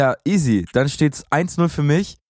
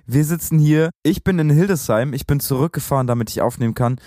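ordinary talk

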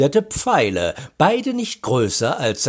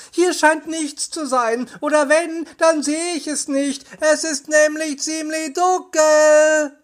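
high monotone call down a tunnel; 14 semitones higher than usual. A narrator's reading. Bob reporting from the dark tunnel, high calling.

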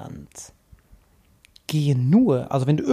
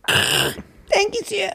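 squeaky grateful thanks; meek high voice. Retold or imitated. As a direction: thank him like a cowed underling.

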